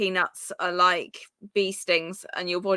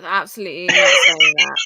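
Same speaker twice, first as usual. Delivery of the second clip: emphatic loud denial; 8 dB louder than usual. Real, not acted. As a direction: press it hard, stretch it out. Flustered denial of accusation.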